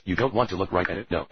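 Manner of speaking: normal talking voice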